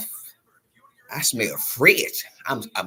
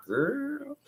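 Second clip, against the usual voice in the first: put-on high, soft drawn girl; high soft sassy girl; 6 dB quieter than usual. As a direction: say it like a pleased sister. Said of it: "she gonna be like".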